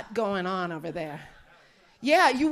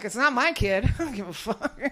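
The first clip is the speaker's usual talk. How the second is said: ordinary talk, nothing marked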